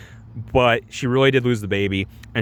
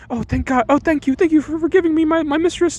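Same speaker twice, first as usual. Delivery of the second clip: high mock-crying thanks; tearful gratitude. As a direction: gush thanks in a fake weepy squeal. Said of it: "Nellie's, like, crying".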